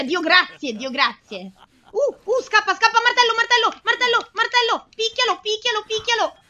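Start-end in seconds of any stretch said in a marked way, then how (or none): 1.9-6.4 high-pitched, repeated urgent commands; squealed, breakneck cheering-on; frantic panic. Real, not acted. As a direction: urge it on in a panic, voice way up. Panicked player during a chase.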